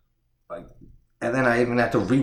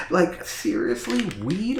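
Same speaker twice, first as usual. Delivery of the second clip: high rising disbelief, like the name can't be real; incredulous; 10 semitones higher than usual. Real, not acted. Reviewer mocking a kid's name, incredulous and high. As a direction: pitch it way up in disbelief.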